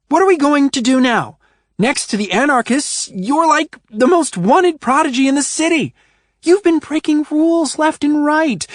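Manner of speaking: high, loud frantic tirade; exasperated rant. A narrator's reading. Ruby ranting at Adrian while pacing, exasperated. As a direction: pace and rant at him.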